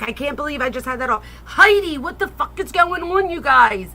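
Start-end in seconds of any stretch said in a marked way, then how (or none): 1.4-3.9 high, loud cry of disbelief; excited shout. Real, not acted. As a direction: call the donor's name out, overwhelmed. Overwhelmed by a donor's gift.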